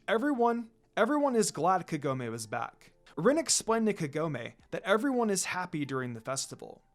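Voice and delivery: usual speaking voice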